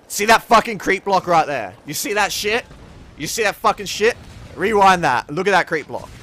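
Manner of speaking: loud, high, exasperated yelling; can you believe this, furious and loud; outraged rant. Real, not acted. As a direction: rage at the replay. Outraged at a creep block.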